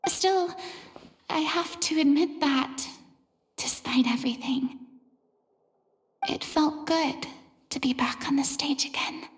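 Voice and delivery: whispered inner thoughts. Real, not acted. Inner thoughts after the show.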